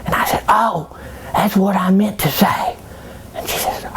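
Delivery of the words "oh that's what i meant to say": slow high whisper. Retold or imitated. Joseph covering his mistake to Mary, whispered.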